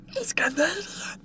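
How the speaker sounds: high, soft whispered aside; secretive whisper. Acted. Streamer repeats chat's remark that he's Canadian, whispered.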